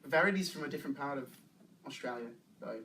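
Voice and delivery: tentative Australian accent; conversational Aussie English. Shown as a demonstration. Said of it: "It's Australian accent".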